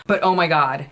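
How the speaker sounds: ordinary delivery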